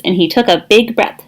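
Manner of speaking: ordinary delivery, nothing marked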